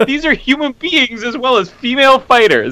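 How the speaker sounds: high, giggly repeat of the line; high-pitched amused echo of the commentator; laughing quote. Retold or imitated. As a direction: replay the nonsense line through amusement. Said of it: "I have no clue what I'm saying".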